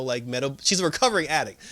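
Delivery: normal talking voice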